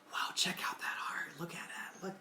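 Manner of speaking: hushed, breathless whisper; soft, voiceless whisper of wonder; awed whisper. Retold or imitated. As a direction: whisper in awe at the stage.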